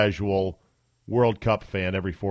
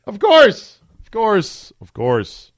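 much higher and louder, a sing-song mocking exclamation; sarcastic of course; 9 semitones higher, 8 dB louder, slower than usual. Real, not acted. Host mocking the lawyer's run for president with a high, sarcastic of course. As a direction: toss it up high like the joke writes itself. Host laughing at Avenatti's pledge.

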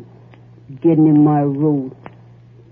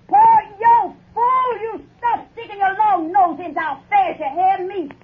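yelling at pa. Acted. Wife shrieks at her pa in the window, furious high yell. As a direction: screech at him to mind his own business. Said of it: "Is that the way to talk to your pa".

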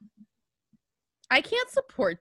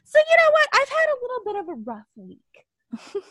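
voice breaking; 9 semitones higher than usual. Real, not acted. Admits rough week, voice breaking.

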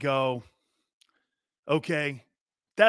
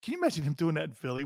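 ordinary talk

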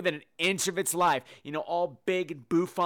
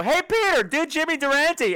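loud, much higher shout at the door; bursting into a salon, shouting a request; calling out; 11 semitones higher, 6 dB louder than usual. Pretend. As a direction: yell in a request as you fling the door open. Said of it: "fling the door open and go".

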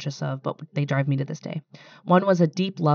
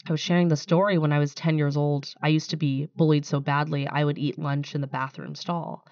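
normal talking voice

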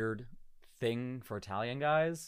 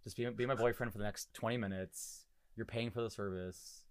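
soft flirty pretend; 4 semitones lower, 9 dB quieter than usual. Daydreaming about whispering to a hot rider, mock seductive. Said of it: "reach over and be like".

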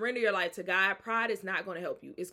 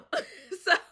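short high laugh; a real laugh; 12 semitones higher, 8 dB louder than usual. Real, not acted. Speaker laughs at her own refusal to God.

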